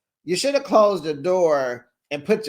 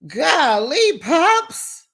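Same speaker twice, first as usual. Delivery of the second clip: shocked exclaim; 11 semitones higher, 4 dB louder, much slower than usual. Real, not acted. Shocked at father's kids.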